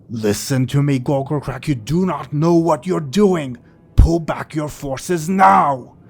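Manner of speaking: rising to a loud, high shout; urgent warning. Acted. Tartarus shouting at Gogokrak to pull back his forces. Tartarus warns the pit lord.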